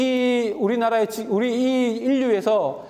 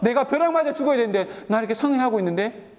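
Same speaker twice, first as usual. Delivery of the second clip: higher, sneering voice with a scornful laugh; arrogant mocking taunt; 5 semitones higher than usual. Preacher voicing the proud wicked man taunting a believer, high scornful sneer. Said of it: "교만한 얼굴로 하나님 나에게 말합니다".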